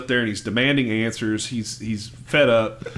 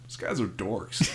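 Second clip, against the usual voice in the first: lower, soft, deadpan imitated verdict; unimpressed kid; 5 semitones lower, 9 dB quieter than usual. Little kid dismissing the two ghost pirates, low deadpan. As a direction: drop it quietly, mock-unimpressed. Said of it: "the little kid just goes".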